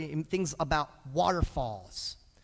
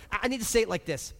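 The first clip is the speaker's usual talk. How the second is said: ordinary delivery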